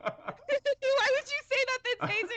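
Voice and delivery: slow laughing protest; kind gentle laugh. Real, not acted. Teased, laughing back.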